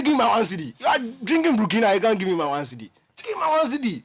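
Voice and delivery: high, loud mock shouting; acted angry demand. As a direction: shout like a mate fighting for change. Mate demands money during acted fight.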